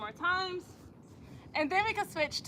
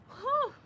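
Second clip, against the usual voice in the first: relieved whew; 11 semitones higher than usual. Real, not acted. Exhale after a hard jump.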